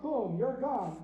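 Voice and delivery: ordinary talk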